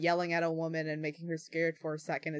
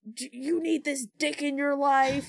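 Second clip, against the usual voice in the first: aggressive jeer, much higher and louder; aggressive catcall; 8 semitones higher, 5 dB louder than usual. Retold or imitated. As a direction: bark it like a drunk guy yelling from a car. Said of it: "they got, like, aggressive".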